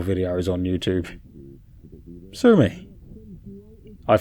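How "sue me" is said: high, cheeky shrug of a line; playful defiance. A narrator's reading. Self-mocking quip.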